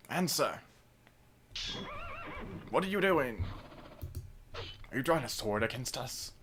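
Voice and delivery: lightly voiced guard confronting the hero. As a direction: demand answers like a wary soldier. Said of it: "horrible voice acting throughout this".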